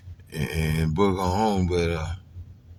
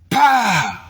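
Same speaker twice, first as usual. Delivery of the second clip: gunshot imitation; 11 semitones higher, 7 dB louder than usual.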